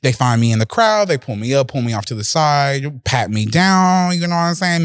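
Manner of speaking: normal talking voice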